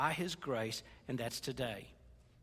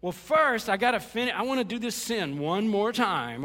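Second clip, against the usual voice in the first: loud, higher-pitched mock pleading; acted stalling excuse; 5 semitones higher, 6 dB louder than usual. Preacher mimicking someone putting off repentance, loud mock insistence. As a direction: push the excuse out loud and high.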